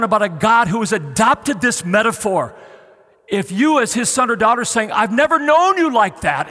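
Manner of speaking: loud, high sermon cry; impassioned preaching. Real, not acted. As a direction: plead with full force. Preaching at full force.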